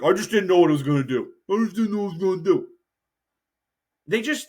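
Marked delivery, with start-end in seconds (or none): 0.0-1.3 rushed, lightly mumbled excuse; hurried excuse. Retold or imitated. Politician rattling off a sheepish excuse, rushed. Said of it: "be like". 1.5-2.7 quicker, more slurred repeat of the excuse; slurred mumbled excuse. Retold or imitated. Politician's sheepish excuse trailing into a fast slur. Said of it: "be like".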